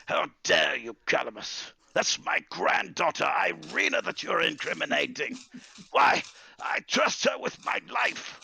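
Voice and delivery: put-on loud, high, outraged old wizard; indignant shouting in defence of family; angry. Grandfather defending Irina to Calamus, angry. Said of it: "contorts into anger".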